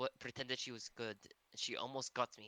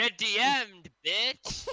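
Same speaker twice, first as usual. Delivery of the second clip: loud taunt; 7 semitones higher, 7 dB louder, much slower than usual. Real, not acted. Mock taunt at player.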